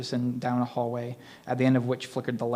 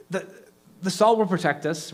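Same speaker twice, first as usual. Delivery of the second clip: stammering, higher and louder; stuttered; 7 semitones higher, 5 dB louder than usual. A narrator's reading. Rhonda stuttering in fear at the ghost. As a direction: trip over the start in fear. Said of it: "Rhonda stuttered".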